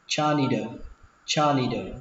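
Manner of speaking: "janitor" pronounced ja-ni-đơ, T softened to D. Shown as a demonstration. Teacher shows second reading, "janitor" ending in "đơ". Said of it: "đọc âm này thành âm đờ".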